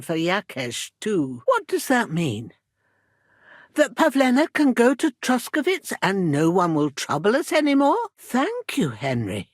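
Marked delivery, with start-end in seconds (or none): 3.7-8.1 high, lifting hopeful question; hopeful disbelief. Acted. Mother asking whether her daughter is safe now, hopeful. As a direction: ask it rising, hardly daring to believe.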